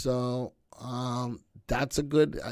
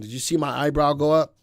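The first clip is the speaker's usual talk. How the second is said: normal talking voice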